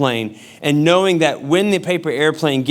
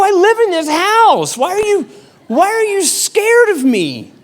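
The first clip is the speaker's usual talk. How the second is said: high, loud mock quarrel voice; hammed-up indignant husband; exasperated retort; 4 dB louder than usual. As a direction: plead it at a yell, pitched way up. Acted quarrel with wife.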